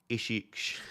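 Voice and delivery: soft, exaggerated Essex accent; hammed-up local Essex pronunciation, said softly. Shown as a demonstration. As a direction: put on a slurred Essex "Ishiksh". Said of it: "doing my Essex accent".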